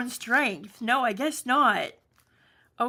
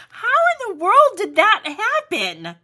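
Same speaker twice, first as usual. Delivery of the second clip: high, loud disbelieving cry; shocked laughter; 9 semitones higher, 11 dB louder than usual. Real, not acted. Paper just ripped unexpectedly.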